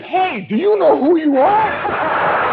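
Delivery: high, slow, loud mock sailor voice; starstruck sailor. Hitchhiking sailor to Kirk in the car, high slow mock voice. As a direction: play the dazed young sailor, drawn out. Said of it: "He said".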